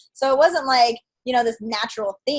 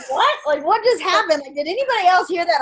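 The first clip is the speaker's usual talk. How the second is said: higher and louder, rushing the question out; stunned, asking the empty room what just happened; startled disbelief; 8 semitones higher, 5 dB louder than usual. Retold or imitated. Woman retelling hearing a voice in the shower, startled and high.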